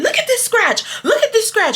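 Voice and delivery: angry customer pointing out the scratch, high and insistent; imitating angry customer. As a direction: jab at the scratch again and again in a high voice. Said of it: "'cause she kept saying".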